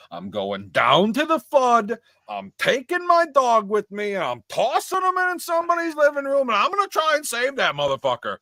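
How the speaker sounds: hammed-up rescue hero, breathy at the end; mock heroic celebrity. Imagining the star rushing into the flood, hammed-up bravado.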